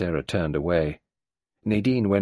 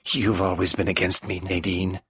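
sharp venomous. Acted. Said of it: "She said sharply and venomously".

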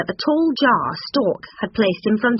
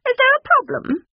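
very high stork voice; high-pitched, falsely concerned bird; stork character; 4 dB louder than usual. A narrator's reading. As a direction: ask with mock innocence as a high-voiced bird. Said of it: "said Stork".

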